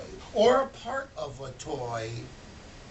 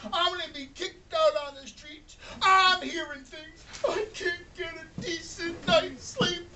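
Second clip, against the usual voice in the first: high, loud, sobbing rant; desperate lament; 9 semitones higher, 5 dB louder than usual. Acted. Broke toymaker lamenting his ruined year, high sobbing rant. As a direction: pour out his despair tearfully. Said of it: "He said".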